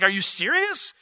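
high-pitched acted disbelief; imitating a disbelieving young challenger; incredulous. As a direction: climb into an eager, incredulous squeal. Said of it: "David's incredulous".

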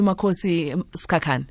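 ordinary delivery

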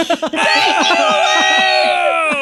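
howling burst of laughter; loud laughing shout. Real, not acted. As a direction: crack up loudly at the buzzer. Buzzer interrupts, host howls.

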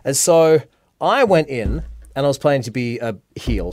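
normal talking voice

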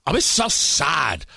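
lightly whiny girl voice, mocking her; mock sad impression. Host mocks the guest's sad answer, light whiny mock. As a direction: pout it out like a sulking guest. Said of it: "I will do it for you".

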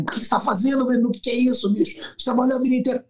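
high, scolding corner voice, imitated; mock harsh coach. Coach imitating a harsher corner yelling at his fighter, mock scolding. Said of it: "como poderia ser mesmo".

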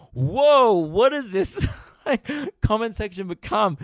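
high mock-shocked outburst, loud on the whoa; exaggerated disbelief. Reacting to what the comment section became, exaggerated disbelief. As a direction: burst out in hammed-up astonishment.

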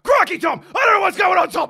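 high, loud frantic yell; re-enacted over-the-top shouting; screaming. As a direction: scream it at your co-commentator. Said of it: "I'm screaming".

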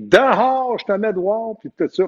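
high, sharp yell of "Dehors" that fades; angry ejection. Retold or imitated. Referee angrily ejecting the coach from the game. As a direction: throw him out of the game.

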